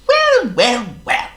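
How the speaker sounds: a chirpy, fussy bird; high loud blackbird voice; Charles the Blackbird. A narrator's reading. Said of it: "said Charles the Blackbird".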